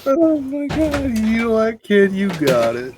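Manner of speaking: shopkeeper voice. Acted.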